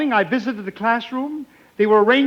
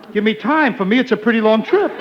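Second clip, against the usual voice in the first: normal talking voice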